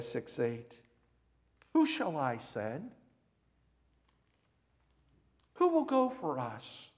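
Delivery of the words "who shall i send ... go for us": voice of God. Acted. God asking for a messenger, grand calling voice. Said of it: "the voice of God".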